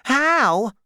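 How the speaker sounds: high, loud jealous outburst; jealous cry. A narrator's reading. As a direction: blurt it out, stung with jealousy. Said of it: "she cried jealously".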